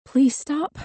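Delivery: high pleading please stop; begging. A narrator's reading. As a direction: beg them to stop. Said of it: "then begged".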